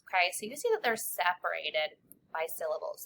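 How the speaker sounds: ordinary talk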